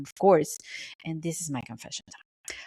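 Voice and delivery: ordinary delivery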